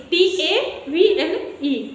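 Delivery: letters spelled out very slowly; deliberate classroom spelling. Shown as a demonstration. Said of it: "table".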